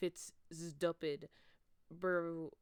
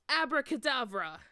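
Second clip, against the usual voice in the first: loud, higher shouted incantation; spell yell; 6 semitones higher, 11 dB louder than usual. Acted. Vampire yelling a spell at Snape and Lupin, loud. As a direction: blast the spell out. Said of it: "he yelled".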